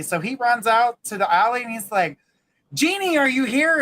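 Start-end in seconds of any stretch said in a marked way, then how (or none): 2.7-3.8 raised-pitch hopeful call. Acted. Man in the alley calling for the genie, calling out. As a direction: call out for someone unseen.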